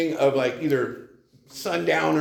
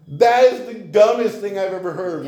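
higher, louder scoffing retort; mock exasperation; 6 semitones higher, 6 dB louder than usual. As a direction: throw it back at him, indignant and loud. Retort to his sponsor, retold.

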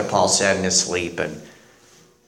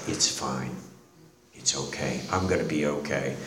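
much softer and lower, gently calming her; soft reassurance; 9 semitones lower, 12 dB quieter than usual. Retold or imitated. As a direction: hush it down, soothe a crying woman. Dying father comforts crying doctor.

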